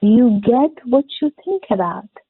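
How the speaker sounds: very slow, each word weighed, stress on think; slow, deliberate slogan; slow emphatic motto. Shown as a demonstration. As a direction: spell the motto out slowly to drive it home. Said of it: "motto repeated".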